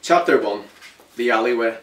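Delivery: storyteller announcing the chapter; chapter heading. A narrator's reading. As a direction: announce the chapter, firm and clear.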